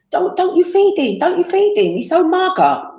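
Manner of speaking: high mock scolding of relatives; imitating nosy relatives. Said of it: "my family or my friends would say".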